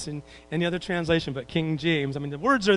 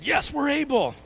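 eager naive disciples; 10 semitones higher than usual. Retold or imitated. Disciples eagerly answer Jesus.

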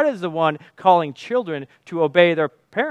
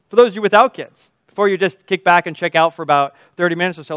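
ordinary talk

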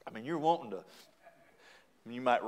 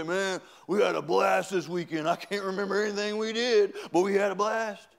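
imitated rowdy coworker, higher pitched; excited party bragging; 5 semitones higher, 4 dB louder than usual. Coworkers bragging about the weekend, mock-excited. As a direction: gush like a weekend partier.

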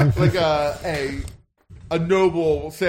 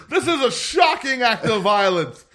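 high, loud appalled announcer; shocked commentator; 8 semitones higher, 5 dB louder than usual. Acted. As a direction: react like a horrified ringside caller. Commentator reacting to violence.

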